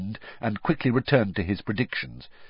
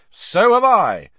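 loud, high, triumphant cry; 11 semitones higher, 10 dB louder, slower than usual. A narrator's reading. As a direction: announce it like a victory. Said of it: "said Ron triumphantly".

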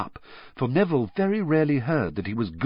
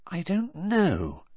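pensive; 9 semitones higher, much slower than usual. A narrator's reading. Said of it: "looking pensive".

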